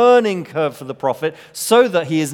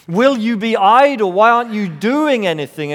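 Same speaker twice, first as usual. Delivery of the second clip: higher and louder, pressing the questions; exasperated plea; 7 semitones higher, 5 dB louder, faster than usual. Retold or imitated. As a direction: demand an answer from God. Prophet's complaint voiced.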